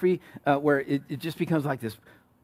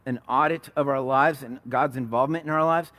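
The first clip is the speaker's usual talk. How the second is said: ordinary delivery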